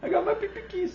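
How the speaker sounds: high squeaky voice on "I got my pee-pee keys"; silly high-pitched brag; squeaky mock pride. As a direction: chirp it like a proud kid. Repeats nickname high.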